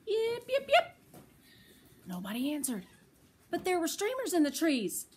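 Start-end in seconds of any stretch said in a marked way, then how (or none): 0.1-0.9 high little fox yips; fox yipping. Acted. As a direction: call out like a curious little fox. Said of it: "perked up her ears and called".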